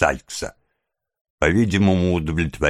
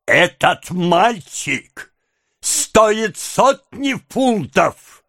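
high, slow, frenzied tirade; furious high-pitched outburst, words dragged out; beside oneself with rage; 10 semitones higher, slower than usual. A narrator's reading. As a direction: rant at high pitch, stretching every phrase. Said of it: "потеряв голову от бешенства".

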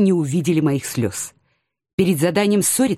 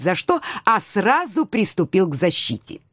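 ordinary delivery, nothing marked

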